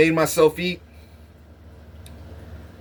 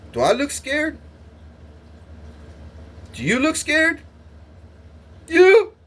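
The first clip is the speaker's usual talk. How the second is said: rising, mocking dare that gets much higher; mocking challenge; 12 semitones higher than usual. Real, not acted. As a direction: throw the question back, higher each time. Mocking who looks scared.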